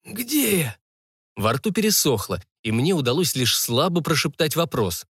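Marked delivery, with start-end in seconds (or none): 0.0-0.8 high, feeble, dry-throated croak; weak croak. A narrator's reading. Dazed patient asking the old man, weak croak. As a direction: croak it weakly from a parched throat. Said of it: "Но вслух я прохрипел ... слабо прошептать".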